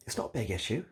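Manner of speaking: ordinary talk, nothing marked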